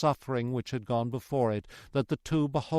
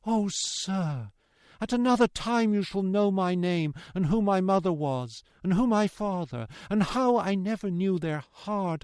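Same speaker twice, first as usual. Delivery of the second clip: higher-pitched plea, softening to tender; daughter's earnest entreaty; pleading daughter; 5 semitones higher than usual. Acted. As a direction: implore him tenderly, fading at the end.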